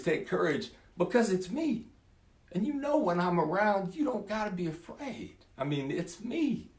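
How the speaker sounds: put-on calm them like frightened children; reassuring Jesus. Jesus calming the brothers in the storm, high soothing voice.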